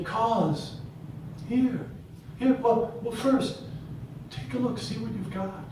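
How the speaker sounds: high, gentle midwife's invitation. Retold or imitated. Midwife voiced in story.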